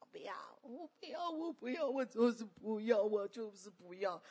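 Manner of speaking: mock whiny child digging in; tearful refusing child. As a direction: imitate a small child stubbornly refusing. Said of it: "满脸都是泪水，死都不要做下去了".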